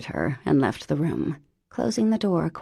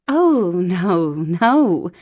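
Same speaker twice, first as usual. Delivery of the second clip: flustered stammer; 7 dB louder, much slower than usual. A narrator's reading. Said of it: "she stammered".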